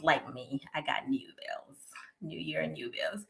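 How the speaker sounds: rueful whisper. Real, not acted. Confessing her own bills to viewers, whispered wryly. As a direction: mutter it under your breath.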